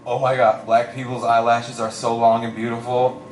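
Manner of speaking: put-on low, drunken admiring voice; drunk guy. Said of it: "he's drunk".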